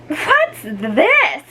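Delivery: high, disgusted question. Acted. Dolores finds underwear on her head, disgusted. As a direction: hold it away from you with your voice. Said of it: "asked Dolores in disgust".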